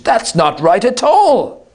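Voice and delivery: high, loud tutting no; scolding teacher. Acted. Foley chiding the student who broke the beam, high and scolding. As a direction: correct an errant student. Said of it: "as one would to an errant student".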